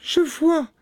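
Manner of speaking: very high falsetto reply; sister Anne. A narrator's reading. Sister on the tower answering, high and calling. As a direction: call down in a high woman's voice.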